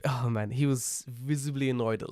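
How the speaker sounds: normal talking voice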